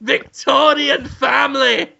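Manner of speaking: hammed-up high, shouted lament. Poor Victorian housemaid complains of her lot, hammed-up shouted wail. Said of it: "And [name] says".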